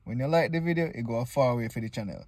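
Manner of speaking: normal talking voice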